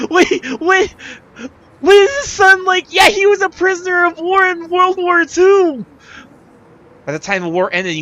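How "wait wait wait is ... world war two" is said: much higher and louder, tumbling out fast; excited realization. Real, not acted. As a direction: blurt it as it clicks. Player realizes a connection.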